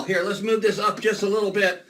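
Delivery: ordinary talk, nothing marked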